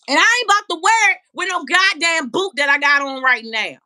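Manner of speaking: high, loud shouted complaint; indignant high yell about the boot; loud rant. Real, not acted. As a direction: shout it in frustration. Ranting about wearing the boot.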